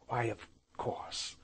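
soft, slow, nearly voiceless; muffled, hidden reply. A narrator's reading. Aunt answers nephew from the sunbonnet, soft murmur. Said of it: "from the depths of the sunbonnet".

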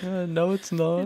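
high, drawn-out mock protest; mock dismay. Speaker answers a useless calendar reminder, high mock dismay. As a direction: plead with the reminder, slow and high.

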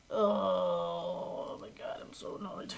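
slow, crying groan sinking to whisper; whiny despair. Real, not acted. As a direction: moan in defeat. Frustrated at game.

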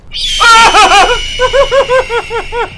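high wavering shriek; startled shriek. Real, not acted. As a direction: scream that shakes on and on.

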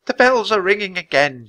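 put-on high, creaky old woman voice. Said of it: "she said in a creaky voice".

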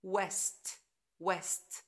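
west with clear W; W sound. Shown as a demonstration. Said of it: "تقروا الكلمات".

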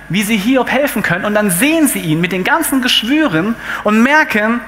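normal talking voice

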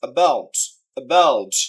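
about low and loud, falling on BOUT; light a, heavy BOUT in about; second-syllable stress. Shown as a demonstration. Teacher demonstrating second-syllable stress, emphatic. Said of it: "দ্বিতীয় সিলেবলটাকে stress দেওয়া হয়েছে".

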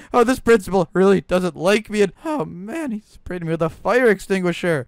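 ordinary delivery

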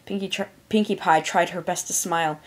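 ordinary delivery, nothing marked

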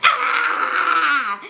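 loud, high, drawn-out imitated yell; mock attack yell. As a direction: let out a long hammed-up attack cry. Imitates the attacker lunging at victim.

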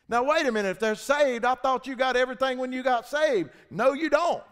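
loud, high, driving preacher's voice; fiery preaching. Acted. Preacher firing up the congregation, loud and driving. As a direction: preach it out full force to the church.

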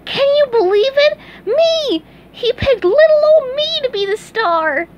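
very high, loud squealing voice; bursting to share the news; cartoon sponge overflowing with joy; excited sponge. A narrator's reading. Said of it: "excitedly said".

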